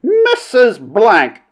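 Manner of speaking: high, very slow drawn-out warning; imperious roar from the chair; roared rebuke. A narrator's reading. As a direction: stretch the name into a reprimand. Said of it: "roared the princess".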